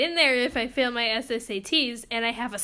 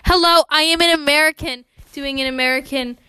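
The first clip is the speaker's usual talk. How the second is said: loud, hammed-up American accent; 8 dB louder than usual. Joking about accents, loud mock American accent. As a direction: announce it in an exaggerated American accent. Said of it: "I have an impression of an American accent".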